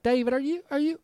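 high-pitched, alarmed questioning; imitated worried woman. Woman fearing David had a stroke, high imitated alarm. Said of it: "She's like".

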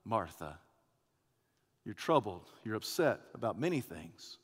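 soft, low, tender reply; calm her gently, unhurried; disarming, affectionate. Acted. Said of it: "in a disarming, affectionate way, simply says".

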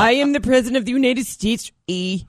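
put-on presidential declaration in a Canadian accent; stately Canadian accent, eh on the end; Donald Sutherland. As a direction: proclaim it with Canadian vowels. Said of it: "Donald Sutherland sounds very Canadian".

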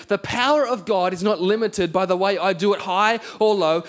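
usual speaking voice

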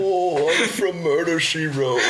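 put-on high, slow old man voice. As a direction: play a doddering very old man. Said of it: "It's a very old man".

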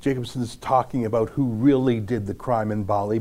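ordinary talk

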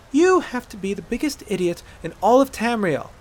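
high-pitched exasperated insult; exasperated scolding. Acted. Aela berating the hunter, exasperated.

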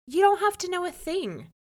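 bossy wife. A narrator's reading. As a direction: wave him off shrilly high. Jamel dismisses husband.

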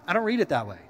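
ordinary talk

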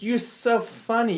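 high, slow sloppy gush; slow high drunk praise; mock drunk fan. Drunk heckler retold.

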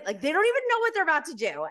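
usual speaking voice

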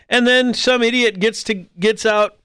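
ordinary delivery

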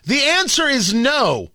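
"The answer is no." slow, firm, stress on answer; emphatic no. Shown as a demonstration. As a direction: spell it out firmly for Trump. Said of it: "allow me".

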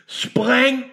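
high-pitched narrated roar. A narrator's reading. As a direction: bellow the command in character. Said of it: "brølede han".

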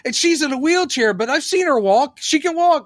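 high, fast mock indignation; squeaky outraged troll voice; mocking hostile heckler. Mocking online troll griping about the wheelchair. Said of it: "she's, like, going".

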